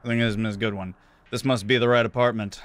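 ordinary talk, nothing marked

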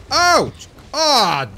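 high, loud ouch; sudden loud cry of pain; pained yelp. Real, not acted. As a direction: yelp as if struck. Hit by the beast.

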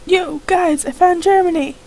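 excited call to friends. Acted. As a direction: shout the news across the room. Calling out to the others.

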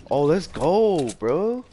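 high, drawn-out victory cheer; hyped gamer bro. Real, not acted. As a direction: celebrate the kill, slowly. Celebrating a kill.

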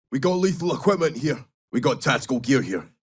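ordinary delivery

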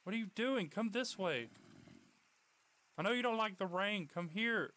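exasperated owner shouting to dog in rain; calling out. Real, not acted.

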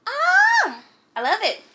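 high, loud squeal of delight; excited squeal. Real, not acted. Vlogger showing viewers a shoe she loves, excited squeal.

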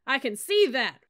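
slow, loud, high barking call; rally the troops at full volume; drill yell. Acted. Sergeant yelling to fire up his cadets. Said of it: "yelled back".

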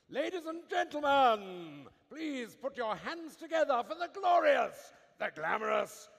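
grand, slow showman's build-up, loud; Master of Ceremonies voice. Pretend. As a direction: announce her like a gala host. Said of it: "in your best Master of Ceremonies voice".

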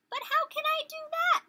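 very high, loud tiny bug voice; puzzled inchworm. Acted. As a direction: squeak it out in alarm. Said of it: "said the inchworm".

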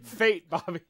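mock-grave Southern dad impression; Hank Hill. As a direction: proclaim it to Bobby in a Texan voice. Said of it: "How would you say feet is Hank".

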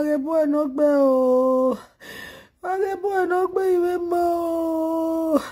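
keen each phrase, dragging out the last word; grief-stricken wail. Real, not acted. Mourner calling for the boy, wailing.

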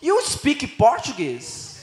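very slow careful English question; slow foreign question. Retold or imitated. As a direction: ask it slowly, word by word. Stranger asks slowly. (pergunta lenta em inglês)

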